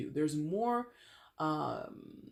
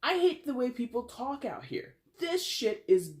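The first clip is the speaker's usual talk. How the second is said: high-pitched, louder mock rant; exasperated; 8 semitones higher, 3 dB louder than usual. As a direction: playact being fed up, close to cracking up. Acting out the irritated newcomer.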